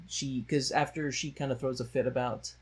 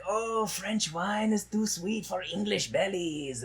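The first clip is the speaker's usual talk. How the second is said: mocking Frenchman; 7 semitones higher, slower than usual. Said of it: "they're like".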